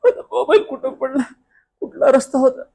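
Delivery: high, whimpering cry of despair; hammed-up crying over a lost phone; tearful lament. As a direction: wail it plaintively.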